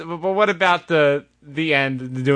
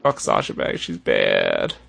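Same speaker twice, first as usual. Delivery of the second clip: low mock grumble; slower than usual.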